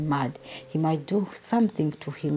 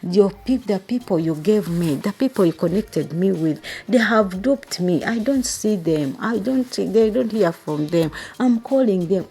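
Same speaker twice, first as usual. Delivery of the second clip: louder, higher indignant rant; agitated outrage; 4 semitones higher, 6 dB louder than usual. Retold or imitated. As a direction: pour out the friend's betrayal in a heated rush. Retelling friend crying about being duped.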